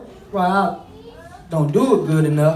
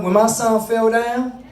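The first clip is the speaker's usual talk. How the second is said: usual speaking voice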